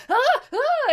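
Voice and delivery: put-on very high, loud panicked child screams; a kid seeing the bed on fire. Bed catches fire, girl panics.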